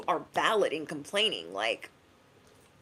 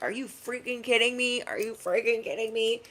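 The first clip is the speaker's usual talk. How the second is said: mock road rage; 5 semitones higher than usual. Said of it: "'cause I can get real road rage".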